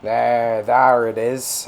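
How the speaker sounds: put-on drawn-out pirate accent. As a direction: stretch it out like a pirate. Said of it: "pirate accent all of a sudden".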